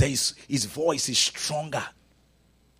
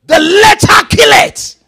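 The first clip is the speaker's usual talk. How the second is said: very high, very loud drawn-out cry; preacher's full shout; 17 dB louder, slower than usual. Acted. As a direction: scream the scripture out like a whooping preacher. Whooping demo at its climax.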